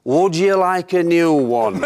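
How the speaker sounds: slow, high, loud Brian Clough drawl through laughter; Clough impression. Retelling Clough offering a new contract, slow laughing Clough drawl. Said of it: "Such a great accent".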